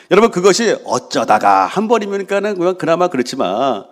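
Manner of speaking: normal talking voice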